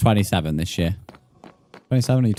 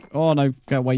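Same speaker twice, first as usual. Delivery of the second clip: ordinary talk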